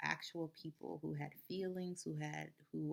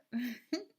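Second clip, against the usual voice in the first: burst of laughter; 7 semitones higher, 6 dB louder than usual. Real, not acted. Laughing at own bit.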